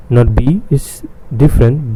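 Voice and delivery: usual speaking voice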